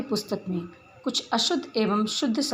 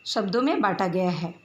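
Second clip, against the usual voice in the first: usual speaking voice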